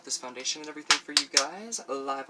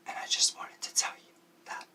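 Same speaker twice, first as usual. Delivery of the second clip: whispered. Real, not acted. Whispering to best friend Ash that he loves her. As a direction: breathe it like a secret.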